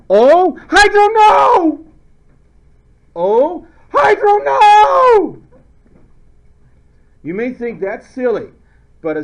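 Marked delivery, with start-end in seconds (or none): 0.1-1.8 high, loud comic scream; over-the-top theatrical shriek; screamed mnemonic. Shown as a demonstration. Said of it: "you screaming". 3.1-5.4 high, loud comic scream; over-the-top theatrical shriek; screamed mnemonic. Shown as a demonstration. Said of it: "you screaming".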